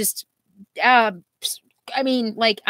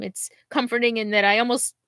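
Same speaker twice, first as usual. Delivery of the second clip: ordinary delivery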